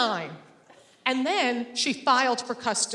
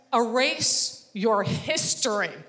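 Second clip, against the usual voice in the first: slow deadpan; much slower than usual. Retold or imitated. Barista deadpan advice.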